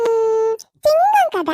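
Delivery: put-on high little girl asking; a small child's eager request. Child asks for lion story. (குழந்தைக் குரல்)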